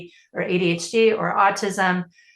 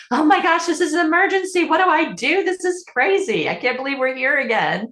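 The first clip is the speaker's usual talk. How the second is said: high, rushed, frantic parent voice; mock panicked parent; 7 semitones higher than usual. Coach imitating a stressed parent's panic, much higher. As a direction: play the overwhelmed parent spiraling into panic. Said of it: "you guys can all hear my voice".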